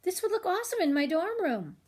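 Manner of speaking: put-on high, eager teen voice; excited teenager. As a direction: sell how cool it would be. Teenager excited about flamingo.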